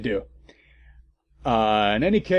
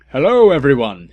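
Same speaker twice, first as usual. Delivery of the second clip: louder, cheery hello; happy greeting; 10 dB louder than usual. Acted. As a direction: greet everyone brightly and a bit louder. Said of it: "he said happily".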